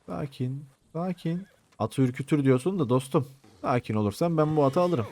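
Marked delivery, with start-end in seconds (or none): 0.0-1.5 soft, slow, gentle shushing; soothing coax. Pretend. Gamer sweet-talking a wild horse, soothing coax. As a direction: coax a skittish horse very softly.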